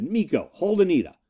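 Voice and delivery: restrained shout of command; shouted order. A narrator's reading. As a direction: call the order out sharply. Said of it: "I shouted".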